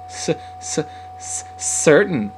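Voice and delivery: unvoiced repeated s before the word; stammer, then push the word out; tongue-tied short answer; stuttering. A narrator's reading. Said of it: "Sam stuttered shockingly".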